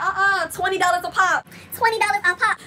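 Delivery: high put-on voice naming the price firmly. Performer charging fans for photos, high imitated refusal. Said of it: "[name] was like".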